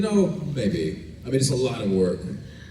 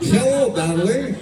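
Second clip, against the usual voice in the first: loud, high, slow put-on voice; put-on character greeting; 6 dB louder, slower than usual. Shown as a demonstration. Actor offering a fan another voice, put-on greeting. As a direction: push it high and draw it out. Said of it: "Which one? What do you, who do you want?".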